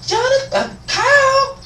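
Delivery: very high falsetto call; put-on administrator voice. Administrator calling student in the lunch line, high mock squawk. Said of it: "almost lost character right there".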